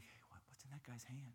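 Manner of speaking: hushed gossip at the casket; whispered question. Retold or imitated. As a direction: whisper at a funeral. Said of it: "We're like".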